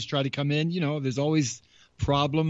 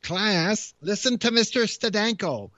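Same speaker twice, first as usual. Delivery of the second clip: teacher voice; 6 semitones higher than usual. Pretend. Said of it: "we're back at school again".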